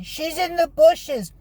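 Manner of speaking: high, slow called-out shout; retold shout. Boy shouting to officers where she hid, high and slow. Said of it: "he's pointing and shouting".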